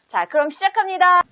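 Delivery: high, loud cheerful start; upbeat kickoff. Real, not acted. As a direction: announce the start brightly. Opening cue to start the video. (신나게 시작을 알리며)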